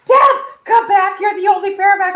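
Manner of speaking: high, loud frantic begging; panicked plea. Retold or imitated. Begging her partner to come back, panicked. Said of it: "And I was like".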